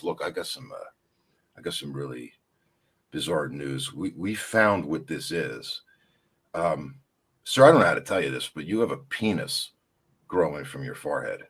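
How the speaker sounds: a hint of a solemn doctor breaking bad news; grave doctor. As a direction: quietly deliver the diagnosis, low and hushed.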